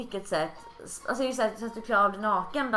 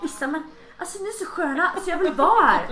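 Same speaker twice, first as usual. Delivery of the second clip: eager friend Johan; 6 semitones higher, 4 dB louder than usual. Retold or imitated. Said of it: "Johan som kom innan och bara".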